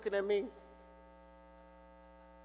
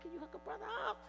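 pleading; 8 dB quieter, much faster than usual. Retold or imitated. Pleading with waitress for more.

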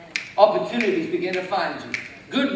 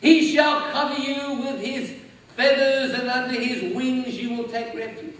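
preached psalm recitation; 2 semitones higher, 4 dB louder than usual. Acted. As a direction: intone the psalm. Recites the psalm, half-sung.

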